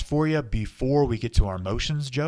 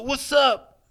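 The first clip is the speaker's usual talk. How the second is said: excited greeting; 10 semitones higher, 9 dB louder than usual. Acted. Greeting guest at door.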